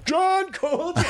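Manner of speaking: very high, hammed-up line reading; totally overacting. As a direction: blurt the name way up high, wildly overdone. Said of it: "You're, like, totally overacting".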